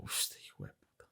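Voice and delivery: breathy whisper under the breath; whispered muttered insult. Retold or imitated. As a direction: curse him silently so he can't hear.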